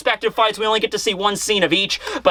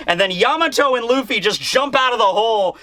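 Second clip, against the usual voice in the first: ordinary talk, nothing marked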